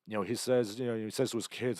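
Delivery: usual speaking voice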